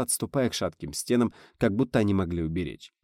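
plain reading voice

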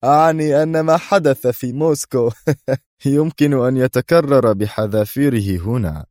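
high, loud gloating; gleeful, menacing boast; laughing threat. A narrator's reading. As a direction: threaten through bursts of laughter. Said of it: "ضحكة عالية أخرى وهو يقول".

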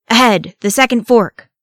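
loud, high command; bitten out. A narrator's reading. Thorin relays the route to the Fellowship, biting it out. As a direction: bite out the order loudly. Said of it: "Thorin bit out".

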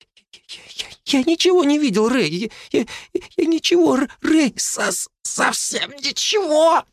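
high, stammering, choked pleading; frightened boy wheezing as he's choked; wheezing in terror. A narrator's reading. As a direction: gasp it out, terrified and stuttering. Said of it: "захрипел он".

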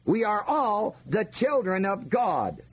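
usual speaking voice

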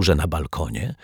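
plain reading voice

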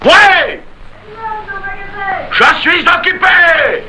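very high, long-drawn shout; yelled answer. Acted. Panisse, nose to nose with Marius, yells back to a caller. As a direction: holler it out, stretching the words.

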